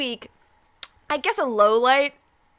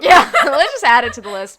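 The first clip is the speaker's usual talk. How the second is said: laughing; 3 semitones higher, 13 dB louder than usual. Real, not acted. Loud laughing quip.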